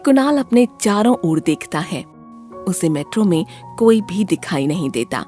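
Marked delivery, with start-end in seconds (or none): none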